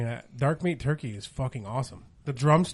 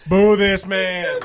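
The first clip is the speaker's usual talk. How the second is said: slow, loud drawn-out boo; 7 semitones higher, 13 dB louder, much slower than usual. Acted. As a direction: boo him like a stadium crowd. Booing a friend's joke.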